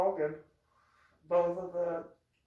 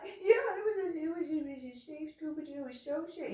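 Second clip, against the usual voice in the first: small, high, soft whine; mimicked cowed little voice; mock whimpering agreement; 12 semitones higher, 5 dB quieter, faster than usual. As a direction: mumble it meekly in a squeaky voice. Mimics partner's meek agreement.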